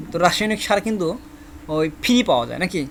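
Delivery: ordinary delivery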